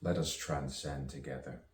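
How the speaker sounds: measured poetic recital with long pauses; spoken-word poetry. Acted. Poet musing aloud on misunderstanding and rebirth, spoken-word delivery. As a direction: recite it as free verse, letting each phrase hang.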